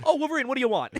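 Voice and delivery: high, loud mock exasperation; put-upon friend. Friend greeting Wolverine who burst in, loud mock exasperation. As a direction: whine at an unwelcome visitor, hammed up. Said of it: "he says in his head".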